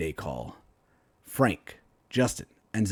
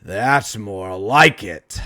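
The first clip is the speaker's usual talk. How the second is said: slow, loud gloating with a grin; grinning villain; 9 dB louder, much slower than usual. Acted. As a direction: savour the fight, slowly. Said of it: "He looks up and grins".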